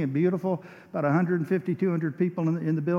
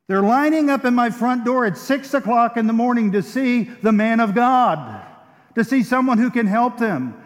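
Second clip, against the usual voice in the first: preaching loud; 4 semitones higher, 5 dB louder than usual. Acted. Preaching at full force.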